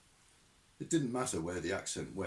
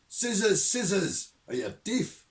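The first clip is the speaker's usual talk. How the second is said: loud, high and irritated; old sergeant barking down the phone at the recruit; impatient sergeant; 9 semitones higher, 8 dB louder than usual. Acted. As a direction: bark it, exasperated. Shadwell impatient.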